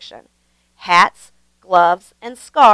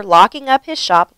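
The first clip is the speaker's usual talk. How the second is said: plain reading voice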